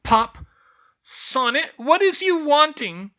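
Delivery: high, loud annoyed squeak; irritated elf squeak. A narrator's reading. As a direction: snap at the child. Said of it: "an irritated older elf squeaked".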